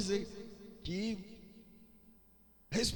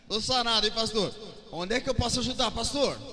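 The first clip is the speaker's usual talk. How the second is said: louder, higher, excited mock volunteer; hammed-up eager offer; eager healed churchgoer; 7 semitones higher, 6 dB louder than usual. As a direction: bounce in, ready to serve the pastor. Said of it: "Mas você vai falar assim".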